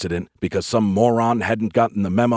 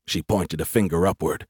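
ordinary delivery

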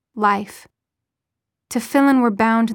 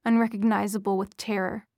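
ordinary delivery, nothing marked

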